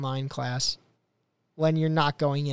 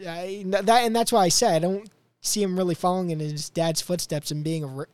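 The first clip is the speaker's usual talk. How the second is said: usual speaking voice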